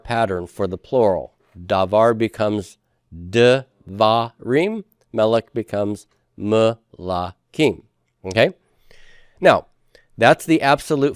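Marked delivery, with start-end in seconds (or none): 3.1-4.8 slow, separated syllables in "devarim". Shown as a demonstration. Teacher showing how davar becomes plural, syllable by syllable. 6.4-7.8 slow "melakim" with gaps between syllables. Shown as a demonstration. Teacher showing how melech becomes plural, syllable by syllable.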